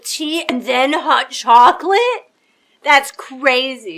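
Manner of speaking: loud, drawn-out astonished exclamation; can't believe what she just heard; amazed disbelief. Real, not acted. Streamer reacting to a game character, loud amazed disbelief. Learns tea comes before chocolate.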